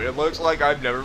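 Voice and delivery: ordinary talk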